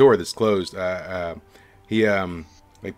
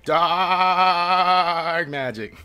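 "Dark magic." dragged out even slower and higher; ominous satyr; 9 semitones higher, 4 dB louder, much slower than usual. Acted. Satyr repeating his warning to the party, very slow and spooky. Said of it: "He says".

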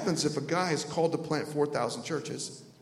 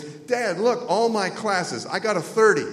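put-on higher, louder, proud kid voice; little Johnny; 4 semitones higher, 4 dB louder, slower than usual. Boy showing dad his report card, proud and high. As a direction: show off to dad with drawn-out words. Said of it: "little Johnny comes in and he says".

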